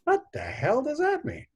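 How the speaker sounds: high, put-on aunt voice, baffled; indignant aunt. Aunt reading the tombstone at the unveiling, indignant. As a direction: squawk it like an offended aunt. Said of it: "my aunt goes".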